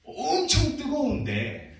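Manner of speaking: 엄청 drawn out long and high; stretched emphasis on 엄청. Shown as a demonstration. Pastor telling children of hot brick kilns, 엄청 dramatically stretched. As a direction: stretch 엄청 slowly to show extreme heat.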